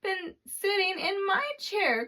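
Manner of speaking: put-on Baby Bear's tiny voice. A narrator's reading. Narrator voices Baby Bear crying over his chair, high and tiny. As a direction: squeak it out, upset little bear. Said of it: "cried the baby bear in his wee small voice".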